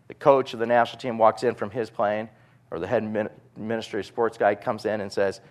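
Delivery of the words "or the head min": ordinary talk, nothing marked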